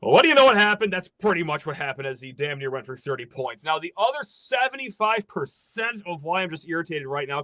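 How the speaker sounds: ordinary talk, nothing marked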